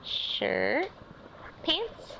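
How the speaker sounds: ordinary delivery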